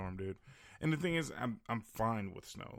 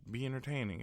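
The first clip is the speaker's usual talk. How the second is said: higher-pitched mocking imitation; imagined dismissive listener; 5 semitones higher than usual. Imagined listener dismisses podcasting as easy, mocking. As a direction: mimic a critic in a lifted voice. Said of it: "And you might say".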